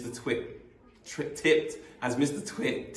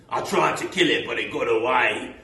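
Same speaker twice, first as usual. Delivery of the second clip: loud, raised voice for alarm; Mister Twit; 8 dB louder than usual. Acted. Mister Twit frightening his wife, loud mock alarm. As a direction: call it out to scare her.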